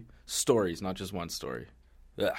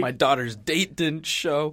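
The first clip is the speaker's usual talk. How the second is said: lightly acted wailing parent, higher and louder; slow, raised-voice mock complaint; mock upset mom; 5 semitones higher, 6 dB louder, slower than usual. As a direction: pile on as a hint of a distraught mom. Co-host joins the acted mom rant.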